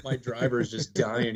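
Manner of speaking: ordinary delivery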